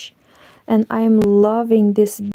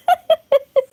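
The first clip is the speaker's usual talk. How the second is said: high laugh. Real, not acted. Laugh at joke.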